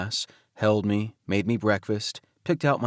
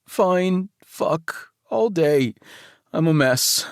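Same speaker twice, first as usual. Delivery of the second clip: higher and louder, a teary overwhelmed rush; bursting into tears; 6 semitones higher, 4 dB louder, much slower than usual. Acted. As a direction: let it spill out, close to crying. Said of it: "I promptly burst into tears".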